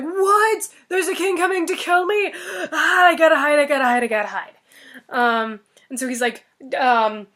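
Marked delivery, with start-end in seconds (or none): 0.0-4.5 higher, loud, yelping panic; panicked ogre. Acted. Ogre panicking that the king will kill him, frantic. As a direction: scramble in terror, voice cracking.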